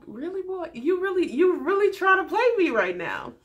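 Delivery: put-on high, sassy "really, boy?"; sassy disbelief. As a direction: call his bluff with attitude. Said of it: "she's just looking at him like".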